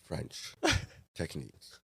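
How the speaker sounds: soft, low, drawn-out reprimand; imagined stern boss. Retold or imitated. Imagined chef scolding his cook, quiet towering menace. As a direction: pause heavily before each weighty word. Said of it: "towering over me".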